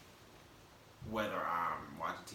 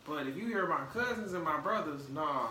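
ordinary talk

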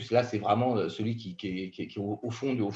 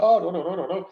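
mock protest; 8 semitones higher, 5 dB louder than usual. Imagined Frenchman refusing poetry.

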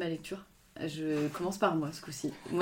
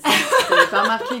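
laughing; 12 dB louder than usual. Real, not acted. Laughing tease.

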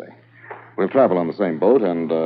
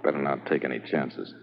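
hushed and softer, wary of being overheard; lowered voice; 4 semitones lower, 8 dB quieter than usual. Acted. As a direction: keep it low so no one in the corridor hears. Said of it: "Keep your voice lowered".

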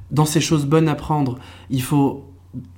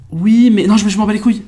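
dismissive; 6 semitones higher, 5 dB louder than usual. Retold or imitated. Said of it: "les gens me répondent souvent".

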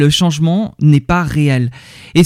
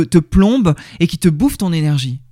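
ordinary talk

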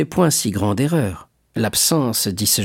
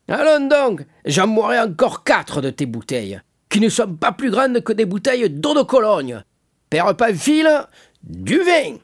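put-on boastful drunkard; 12 semitones higher than usual. A narrator's reading. Caderousse calling the innkeeper for wine, drunken bravado. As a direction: call out like a drunk wanting more.

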